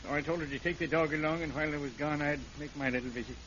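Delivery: low, soft, quick telling; quiet confession. Acted. Killer telling the sheriff how he did it, low and quick. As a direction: murmur it out fast and low.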